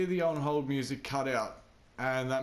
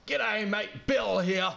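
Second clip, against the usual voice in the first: punchy, broad Aussie greeting, pitched up; Bill, Australian accent; 6 semitones higher, slower than usual. Retold or imitated. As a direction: imitate an intimidating Australian bloke on the phone. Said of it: "'cause it was like".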